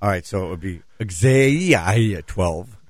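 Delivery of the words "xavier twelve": "Xavier" stretched as gzay-ee-eye-uh, then twelve; laboured, many-vowelled "Xavier", gzay-ee-eye-uh; trying the X-Z sound. Shown as a demonstration. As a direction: slowly work through "Xavier" with a gz start. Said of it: "so try it".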